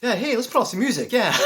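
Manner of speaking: high, loud, overly breezy denial; hammed-up nonchalance to friends; playing it cool. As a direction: fake being totally fine. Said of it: "playing it cool".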